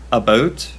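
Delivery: "about" said with a Canadian vowel; Canadian pronunciation. Shown as a demonstration. American recalling the one word she noticed, Canadian pronunciation. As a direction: demonstrate the Canadian way of saying "about". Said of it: "the only thing I ever noticed was the word".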